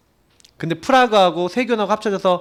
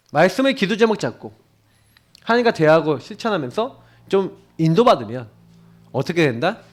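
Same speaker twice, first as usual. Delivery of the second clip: ordinary delivery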